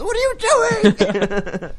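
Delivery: slow high yell through a laugh; high drawn-out call. Pretend. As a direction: call out in alarm. Resident calling out at dogs.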